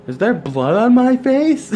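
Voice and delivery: high, loud, slow, panicked asking; alarmed question. Retold or imitated. Passenger asking the girl beside her, alarmed and high. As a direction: plead for an answer in shock. Asking stranger about bloody face.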